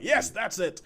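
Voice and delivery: high-pitched quoted agreement; imitating an enthusiastic commenter; imitated keen agreement. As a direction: act out an eager yes. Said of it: "And she's like".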